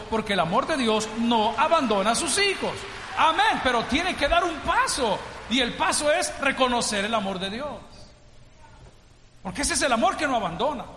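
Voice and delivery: preacher urging the congregation with fervor; full-force preaching. Real, not acted. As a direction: preach it out loud.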